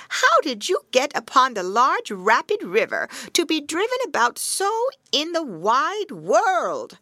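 put-on old witch voice. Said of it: "said the old woman".

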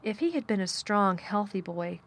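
ordinary delivery

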